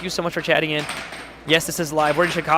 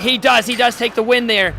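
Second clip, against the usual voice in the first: higher, louder triumphant call of the win; excited call; 5 semitones higher, 4 dB louder than usual. Real, not acted. Commentator calling the set win, excited. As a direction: punch out the winning moment.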